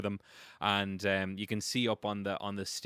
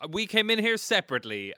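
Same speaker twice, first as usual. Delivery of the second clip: raised, high-pitched indignant shout; acted shout; 9 semitones higher, 6 dB louder than usual. Game master voicing the player's shout to townsfolk, shouted. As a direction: call it out loudly to the whole crowd. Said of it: "you, you shout".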